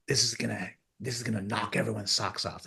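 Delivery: breathy retold whisper of an excited prediction; whispering the big prediction at the table; hushed whisper. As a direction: lean in and whisper it like a secret.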